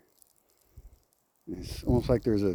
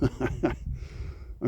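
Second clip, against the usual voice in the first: breathy little laugh; soft laugh; 8 dB quieter than usual. Real, not acted. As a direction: laugh it off softly. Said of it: "all I can really do is laugh".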